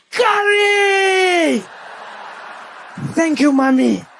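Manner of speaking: overjoyed mock wail of gratitude; ecstatic scream. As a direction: scream it out in hammed-up rapture. Teller screams in delight at curry.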